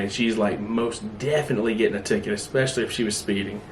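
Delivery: usual speaking voice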